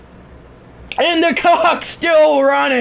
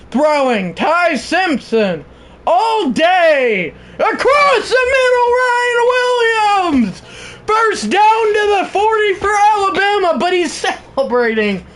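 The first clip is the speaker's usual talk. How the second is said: live play-by-play; 9 semitones higher than usual. Acted. Streamer calling the Alabama drive to chat, excited. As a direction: build the call to a yell as the pass sails incomplete.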